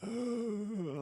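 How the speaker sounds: breathy rasping gasp like a zombie; mock a drugged zombie groan. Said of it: "like a fucking zombie".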